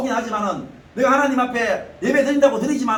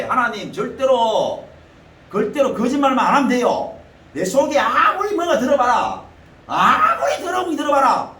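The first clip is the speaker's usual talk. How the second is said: fervent preaching; 3 semitones higher, 4 dB louder than usual. Acted. Preacher urging the congregation, fervent insistence. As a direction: drive the point home to the congregation. Preacher stressing never lie.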